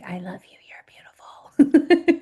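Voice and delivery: demonstrated whisper. Shown as a demonstration. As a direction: whisper sweetly, modelling it for the kids. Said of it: "You whisper things like".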